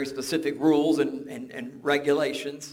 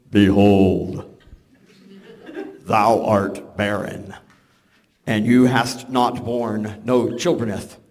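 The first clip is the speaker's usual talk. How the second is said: lower, louder, slow archaic angel proclamation; hammed-up thee-and-thou angel voice, lower and slower; mock King James angel; 5 semitones lower, 7 dB louder, slower than usual. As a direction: boom it out like an old Bible angel. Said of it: "I can hear this".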